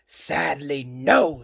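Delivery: low, dry wolf reply; sly wolf. Acted. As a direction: drop low in mock regret. Wolf admits disguise.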